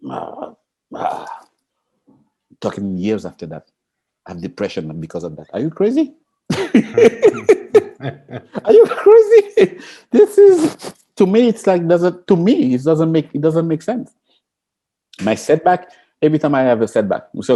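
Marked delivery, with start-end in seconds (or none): 5.5-14.1 high, loud laughing "Are you crazy?"; laughing disbelief. Real, not acted.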